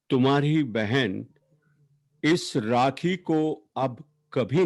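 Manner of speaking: declaimed elegy, each phrase set apart by pauses; poetry recitation. Acted. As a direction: open phrases strongly, then let them sink. Recites own poem for late sister.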